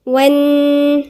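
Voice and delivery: والن- held on a stressed, doubled nun; slow demo of doubled nun. Shown as a demonstration. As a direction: draw out والن- slowly. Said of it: "حرف قلنا مشدد عند النون".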